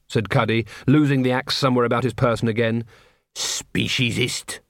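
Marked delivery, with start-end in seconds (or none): 3.3-4.6 muttered insult. A narrator's reading. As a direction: draw the accusation out under your breath. Cuddy mutters an insult at troll.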